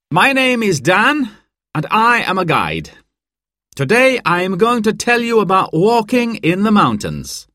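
high, projected group-briefing voice; mountain guide presenting. A narrator's reading. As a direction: introduce yourself to guests, pitched well up. Guide Dan addresses the guests.

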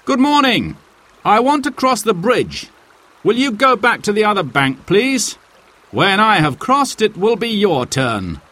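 calling high across the bridge to a stranger; polite call over the water. Acted. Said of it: "called out to the man".

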